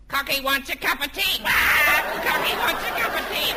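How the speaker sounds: a hypnotised man squawking as a parrot. Acted. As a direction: squawk it out like a pet cockatoo. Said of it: "convince [name] he's not a parrot".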